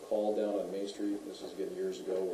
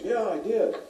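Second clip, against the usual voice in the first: high, louder quoted answer; imitated rider's admission; 12 semitones higher, 8 dB louder, slower than usual. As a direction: blurt it as the rider's reply.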